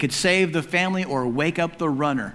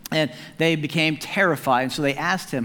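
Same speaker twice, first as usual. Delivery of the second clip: ordinary delivery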